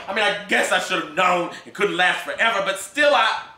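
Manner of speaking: blurting out a confession, voice climbing; pleading mother. Acted. Emma Jean confessing to Gus, pleading and rising.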